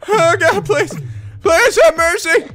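high-pitched mock pleading; begging for mercy. Victim asking the attacker for mercy, begging.

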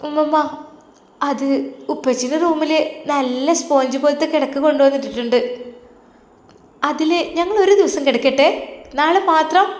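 put-on high, childlike voice scolding grandma; little girl voice. Little granddaughter correcting grandma, high childlike voice. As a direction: play a small girl correcting her granny.

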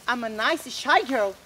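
usual speaking voice

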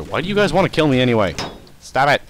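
annoyed yell at attackers; exasperated. Real, not acted. Assassin attacks player.

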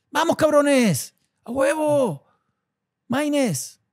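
root for him like a fan in the stands; cheering. Real, not acted. Cheering wrestler in video.

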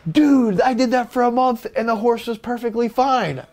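hammed-up excited Canadian accent; excited quote. Retelling Clark's excited reply.

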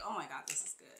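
low, soft aside of pleasure; hushed delight. Real, not acted. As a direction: drop the voice, savouring the scent. Savouring the scent mid-explanation.

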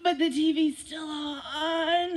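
slow, whining complaint about the TV; whiny kid. Retold or imitated. Teacher mimics a kid whining at mom's dinner call. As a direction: stretch it out like a kid dodging chores. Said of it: "and you're like".

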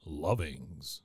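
low, drawn-out radio announcer voice; radio voice impression. Shown as a demonstration. Host announcing the guest's name, best radio voice impression. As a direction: savour the name slowly in a deep radio voice. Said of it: "my best radio voice impression".

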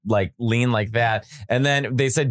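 normal talking voice

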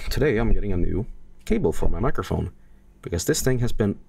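ordinary delivery

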